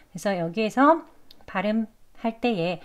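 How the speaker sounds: usual speaking voice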